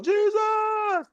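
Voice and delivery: high, eager mock yell of a name; high, drawn-out hailing call, slow at the end. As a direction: wave someone down in a crowd. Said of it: "started yelling".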